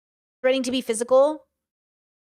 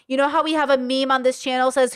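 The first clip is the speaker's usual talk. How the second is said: ordinary talk, nothing marked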